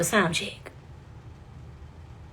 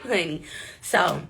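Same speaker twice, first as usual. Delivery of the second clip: normal talking voice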